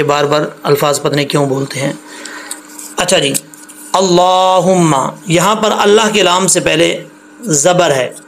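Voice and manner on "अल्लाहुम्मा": अल्लाहुम्मा with a full, heavy doubled L; slow, careful अल्लाहुम्मा with thick L. Shown as a demonstration. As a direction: say अल्लाहुम्मा slowly, holding the long "laa". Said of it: "तो इसको मोटा पढ़ेंगे".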